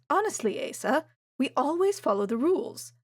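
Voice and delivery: a prim mother caught out, a bit indignant; mother protesting, pitch up; flustered mother. A narrator's reading. Mother defends herself, flushing.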